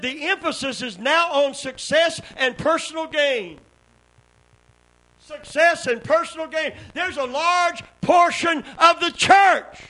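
high, loud shouted preaching; climbing sermon shout; preaching at full force. Acted. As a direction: whoop it up like a revival preacher.

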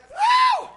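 sudden high shouted woo; high preacher whoop. Acted. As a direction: let out a joyful whoop.

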